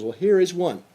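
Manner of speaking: normal talking voice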